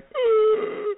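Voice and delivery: very high strangled gasp; imitated breathless gasp. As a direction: imitate someone unable to breathe. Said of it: "he can't breathe".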